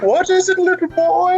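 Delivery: put-on high, singsong Santa to a child; hammed-up Santa answering in a high pitch. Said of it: "I'm doing my Santa accent".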